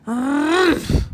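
loud, high cartoon grumpy "hmph"; hammed-up huff of annoyance; grumpy harrumph. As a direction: harrumph like a cross little panda. Grumpy panda harrumphs in story.

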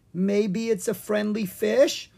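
high, slow and tentative hope; hopeful. A narrator's reading. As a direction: suggest it hopefully, pitch lifted. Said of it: "Owen said hopefully".

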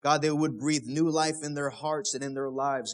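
ordinary talk, nothing marked

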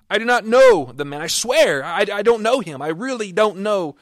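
loud, high, desperate protest; Peter denying with an oath. Acted. Peter cornered by the crowd, denying loudly and desperately. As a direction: swear you never knew him. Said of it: "He denied with an oath".

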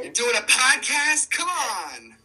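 high excited hype; hype man cheering. Pretend. Hyping Kayla as hype man.

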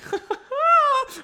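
real laugh, very high; high squeaky laugh. Real, not acted. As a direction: crack up mid-sentence. Laughs at hockey sticks joke.

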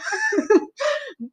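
squealing high-pitched giggle; a helpless giggle breaking out. Real, not acted. Giggling fit over sirens.

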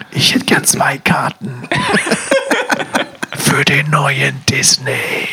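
slow, dramatic whisper at the ticket desk. Pretend. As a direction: whisper it like a secret. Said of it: "so ein bisschen dramatisch". (dramatisch geflüstert)

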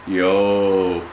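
talking with teeth clenched shut; words squeezed through closed teeth; through gritted teeth. Shown as a demonstration. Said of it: "Sounds like somebody's talking through their teeth".

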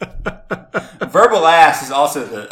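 slow, laughing through the words; cracking up at the joke, drawn out. Real, not acted. Joke about verbal ass.

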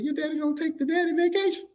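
put-on high, innocent baffled kid; naive disbelief. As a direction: ask like a puzzled child. Child asking in disbelief.

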